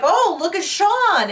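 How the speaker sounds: high, slow swooning praise; mock admiring. Drunk husband imagining everyone admiring him, high and gushing. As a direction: gush over him in a high voice. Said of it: "everyone was like".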